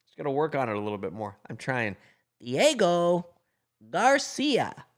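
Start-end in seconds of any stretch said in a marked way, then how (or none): 2.4-4.9 viewer's name in a high cartoon squeak; SpongeBob voice. Vlogger greets a viewer in his SpongeBob voice, very high. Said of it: "It's a little bit something there".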